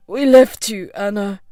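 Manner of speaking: a heavy, regretful admission. A narrator's reading. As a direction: voice thick with regret. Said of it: "his voice thick with regret".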